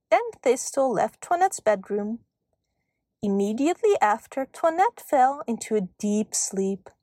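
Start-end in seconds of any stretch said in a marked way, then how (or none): none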